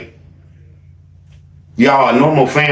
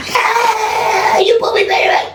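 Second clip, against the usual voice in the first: very high shouted teasing cheer; mock sibling cheer; slower than usual. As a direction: squeal it out like a cheering kid. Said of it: "siblings is coming into the bathroom".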